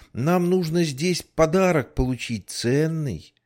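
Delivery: put-on child voice. Child pleads with policeman.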